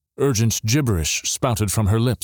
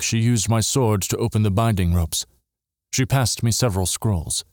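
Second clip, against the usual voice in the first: plain reading voice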